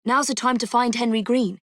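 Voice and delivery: ordinary delivery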